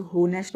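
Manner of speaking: होनेस्ट opening on an audible h; voiced H in होनेस्ट. Shown as a demonstration. Teacher quizzing students on the mistake, होनेस्ट with sounded h. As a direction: say होनेस्ट as "ho-nest". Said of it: "नहीं".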